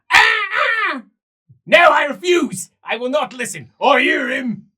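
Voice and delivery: high, loud cries of refusal; anguished refusal. Acted. Tormented man refuses the demon's call, crying out. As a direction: fight off the voice in his head.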